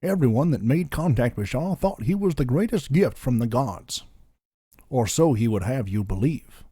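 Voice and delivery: put-on Georgian-accented Southern narrator; Southern storyteller's Georgian lilt; lazy Georgian accent. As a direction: tell it like To Kill a Mockingbird in a Georgian accent. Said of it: "You're slipping out of Goofy".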